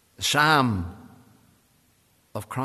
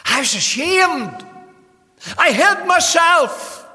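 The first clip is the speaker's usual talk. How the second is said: preached cry; 14 semitones higher, 9 dB louder, slower than usual. Retold or imitated. Preacher cries out Adam's confession.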